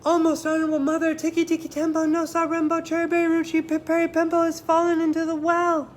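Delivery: put-on high, loud, fast child's call. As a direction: push it out on one deep breath. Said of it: "Chang took a deep breath".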